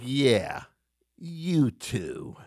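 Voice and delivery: drawn-out, dismissive echo; imitated disdain. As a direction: repeat the star's snub with a pause. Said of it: "So he was like".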